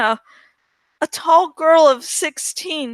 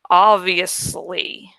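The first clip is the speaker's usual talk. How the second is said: slow, weary condescension; exaggerated patience; 8 semitones lower, slower than usual. A narrator's reading. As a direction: lower and slow, drawn-out patience. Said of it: "with exaggerated patience".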